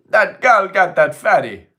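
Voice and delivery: high, loud put-on voice; hammed-up shout. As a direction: yell it out in a silly high voice. Shouting a joke line in voice.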